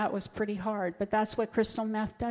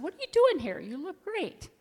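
cheerful greeting; 7 semitones higher than usual. Retold or imitated. Chaplain greeting a young patient, cheerfully surprised. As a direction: burst in delighted to see him.

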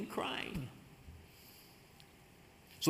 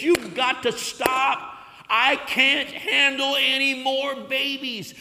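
high, loud and slow, pleading with God; anguished cry to God; 8 semitones higher, 5 dB louder than usual. Retold or imitated. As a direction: cry out to heaven, overwhelmed and desperate. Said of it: "I cried and said".

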